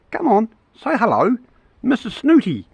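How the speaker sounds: high playful coaxing voice; high teasing coax to a wild bird. Real, not acted. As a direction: sweet-talk the snooty bird up high.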